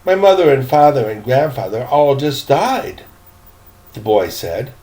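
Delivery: plain reading voice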